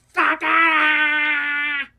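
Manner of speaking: high, loud, drawn-out yell of disbelief; shocked yell. Retold or imitated. Told she has herpes, he screams in long disbelief. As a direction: stretch it out, hollering in horror.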